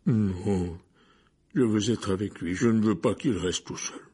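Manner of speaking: forceful refusal in an altered voice; upset big man. A narrator's reading. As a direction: insist heavily, in distress. (voix de personnage, bouleversée et insistante)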